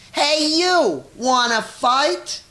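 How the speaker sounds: high, loud challenge from a tiny bug; grouchy ladybug. A narrator's reading. Ladybug squaring up to the rhinoceros, high and loud. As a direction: squeak it out like a picking-a-fight bug. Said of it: "ladybug said to the whale's tail".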